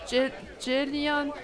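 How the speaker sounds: hesitant, slow try at a Japanese accent. Shown as a demonstration. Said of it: "say Jillian with a Japanese accent".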